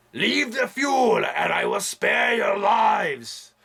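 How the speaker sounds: high, slow shouted warlord decree; villain proclamation. Retold or imitated. Vlogger quoting Humungus from The Road Warrior as a shouting warlord. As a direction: bellow it like a wasteland warlord.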